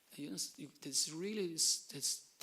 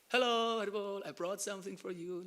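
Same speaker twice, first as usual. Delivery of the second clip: bright, louder, higher hello at the door; cheerful greeting; 5 semitones higher, 7 dB louder than usual. Retold or imitated. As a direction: greet them brightly, full of joy. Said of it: "like this".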